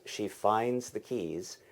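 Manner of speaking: ordinary delivery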